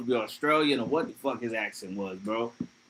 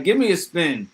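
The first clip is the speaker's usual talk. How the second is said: usual speaking voice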